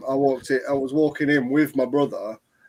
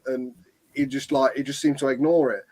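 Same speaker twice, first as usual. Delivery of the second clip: ordinary delivery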